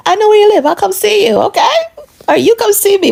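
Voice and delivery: sweetly mock him; mocking sing-song. Taunting her husband about seeing each other, high and teasing.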